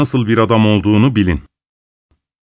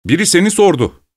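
plain reading voice

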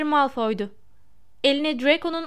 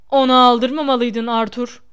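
big gruff friend; 8 dB louder than usual. A narrator's reading.

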